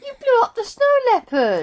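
high, loud exclamation; excited shock. Real, not acted. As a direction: shout it out in amazement. Shocked at game event.

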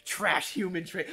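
normal talking voice

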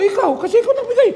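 telling the giver he'd just name him; eager blurt. Retold or imitated. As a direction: blurt it out proudly and quickly. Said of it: "Sabihin ko".

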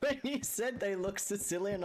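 ordinary talk

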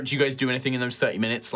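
ordinary delivery

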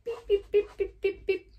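high, short beeps; playful string of little honks; imitated bus horn. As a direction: beep like a toy bus. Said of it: "So, here's my bus".

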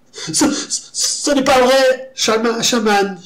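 high, slow terrified whine; whimpering. A narrator's reading. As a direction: stammer and plead in fear. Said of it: "gémit la victime".

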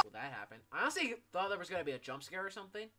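usual speaking voice

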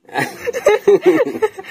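high squealing laugh; cracking up at her face; burst of laughter. Real, not acted.

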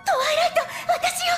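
distressed plea. Acted. Pleading in distress for trust.

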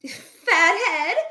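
high, loud insulted screech; screaming. A narrator's reading. Flo exasperated at Fosdyke's fries, screaming. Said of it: "screamed Flo".

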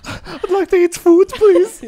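high, slow, laughing plea for food; mock polite plea. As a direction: beg sweetly while giggling. Customer pleading with rude waiter.

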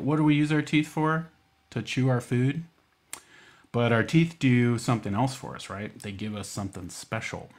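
normal talking voice

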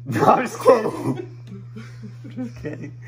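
high giggling through words; laughing. Real, not acted. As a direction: laugh while taking it back. Laughing off own joke.

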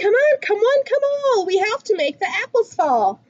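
plain reading voice

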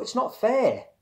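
put-on high, loud childish protest; shouted tantrum. A narrator's reading. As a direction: stamp and shout the grievance. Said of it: "he shouted, stamping his foot".

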